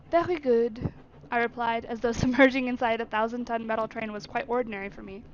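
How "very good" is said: put-on French-accented r in a short reply; reply in a clear French accent. Servant answering the train guard, French accent. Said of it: "French accent is so much easier".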